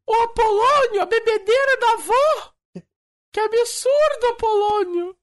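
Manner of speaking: high, loud scolding character voice; shrill comic shout. Acted. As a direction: yell it like a scandalised old lady.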